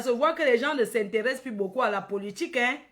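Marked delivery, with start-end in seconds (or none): none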